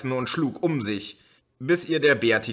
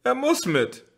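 much higher, almost shrieking; shrill desperate repeat; 4 dB louder than usual. A narrator's reading. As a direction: repeat it close to breaking. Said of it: "sagte Jana Bertha". (schrill und verzweifelt)

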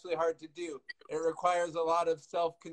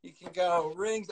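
ordinary talk

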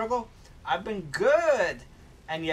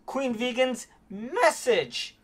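higher pitched playful scolding; mock outrage; 7 semitones higher than usual. Streamer scolding Twitch's text-to-speech in mock outrage. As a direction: pretend to be offended.

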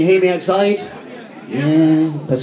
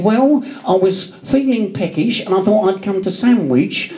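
higher-pitched bad impression of a mate; Dave Lee; 5 semitones higher than usual. Friend quoting his peckish excuse, bad mock impression. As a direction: put on his accent, badly, for the gag. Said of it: "That impression was so bad".

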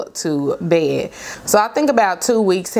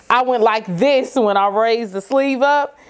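ordinary talk, nothing marked

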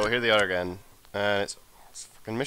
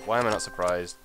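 ordinary talk

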